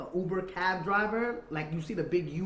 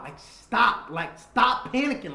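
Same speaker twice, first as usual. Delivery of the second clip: louder, urgent begging to stop panicking; panicked plea; 7 semitones higher, 11 dB louder, slower than usual. Retold or imitated. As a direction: beg the frightened driver to calm down, loud and slow. Panicked plea to Uber driver.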